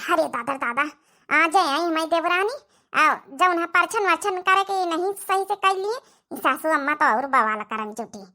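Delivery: ordinary talk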